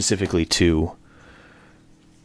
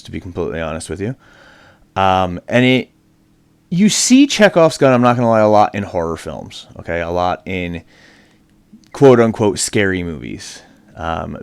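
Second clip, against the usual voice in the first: normal talking voice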